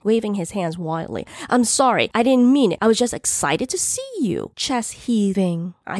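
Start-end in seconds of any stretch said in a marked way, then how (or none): 1.5-4.5 high, pleading excuse; loud apology. A narrator's reading. Man to livid woman he startled, loud pleading. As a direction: plead innocence in a raised pitch. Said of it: "he said loudly".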